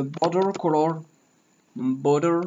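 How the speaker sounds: mock English accent on "Border". Shown as a demonstration. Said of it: "j'adore mon accent".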